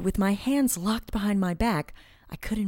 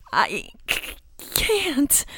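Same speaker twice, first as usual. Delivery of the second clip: broken, panicky stutter; distressed stammer; 9 semitones higher, much slower than usual. A narrator's reading. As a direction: catch on the word, then go high. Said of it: "I stammered".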